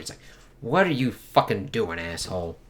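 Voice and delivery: usual speaking voice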